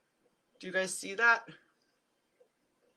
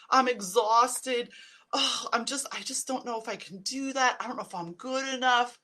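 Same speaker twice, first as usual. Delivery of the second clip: whiny negative self-talk; 4 semitones higher, 8 dB louder than usual. Retold or imitated. Vlogger mocking the way we moan to ourselves, loud whiny complaint. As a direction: moan it like the everyday complaints we all make.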